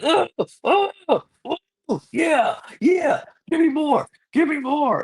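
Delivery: high-pitched mock groans and eager cries; hammed-up tough guy. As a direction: imitate someone relishing being shocked. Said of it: "Derek takes it like a G".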